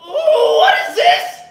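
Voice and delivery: very high, shouted outraged shriek; Lemongrab impression. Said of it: "Morty is the same voice as Lemongrab".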